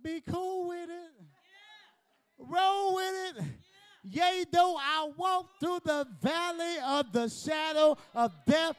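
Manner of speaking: high, loud, slow shouted preaching; full-force preaching. Acted. Preacher rousing the congregation with a slow, loud, high shout.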